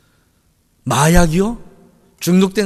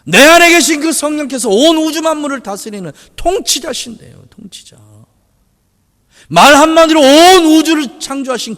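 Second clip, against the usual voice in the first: loud, high, driving sermon delivery; full-force preaching; 7 semitones higher, 7 dB louder than usual. Acted. Pastor to the congregation, full-force preaching. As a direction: preach it out with rising fervor. Preaching about the Spirit's power.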